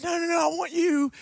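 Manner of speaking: high, pushy cry of no, no, no; hammed-up pastor refusing to take no; mock insistent shout. Pastor at lunch pushing Joseph to open up, mock shouting.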